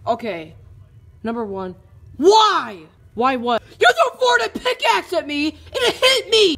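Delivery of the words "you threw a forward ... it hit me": angry high shout about being hit; angry accusation. Acted. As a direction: yell it at the thrower. Hit by pickaxe.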